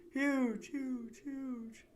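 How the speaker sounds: huge sent back high and ringing; cave echo. Shown as a demonstration. Stalagmite cave echoing Jasper's words, ringing echo. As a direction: bounce huge off the walls, fading. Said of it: "It echoes off the cave walls".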